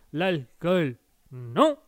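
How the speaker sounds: very slow, drawn-out [name] sing-song; [name] impression. Streamer repeats [name]'s line for the chat, very slow. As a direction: drag out l'eau ferrugineuse like [name]. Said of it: "[name] le disait si bien".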